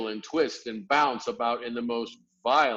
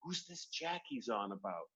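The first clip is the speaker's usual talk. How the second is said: much softer hushed question; whispered; 13 dB quieter than usual. A narrator's reading. Sophie whispering to the BFG. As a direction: ask under your breath.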